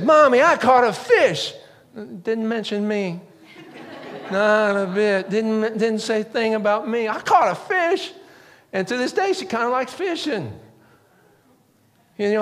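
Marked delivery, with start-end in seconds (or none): 0.0-1.5 put-on high, proud child voice; little girl. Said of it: "first thing she said". 7.1-8.1 put-on high, proud child voice; little girl. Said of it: "first thing she said".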